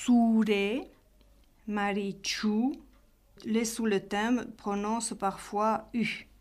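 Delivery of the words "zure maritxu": "Zure, maritxu" with every U as French ou; U prononcé ou. Shown as a demonstration. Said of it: "Le U se prononce OU". (« Zure, maritxu » : chaque u dit ou)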